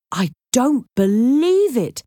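incredulous, words drawn out; disbelieving. Acted. Jake sees the statue on the table, disbelieving.

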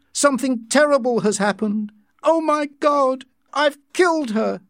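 high, horrified outcry; panicked shouting. A narrator's reading. John fearing he has killed Linda, panicked. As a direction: cry out in panic, pitch soaring. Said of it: "he shouted".

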